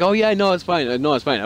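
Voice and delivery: high, bright fake-nice reassurance; mock polite customers. Customers fake-reassuring the new cashier, high and sugary. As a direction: sing it out falsely polite, then drop.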